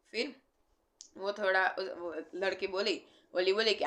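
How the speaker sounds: ordinary talk, nothing marked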